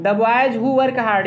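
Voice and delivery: each word dragged out for writing; slow dictation. Shown as a demonstration. Teacher dictating the sentence slowly to students. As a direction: dictate slowly so they can write. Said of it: "dictating a translation answer".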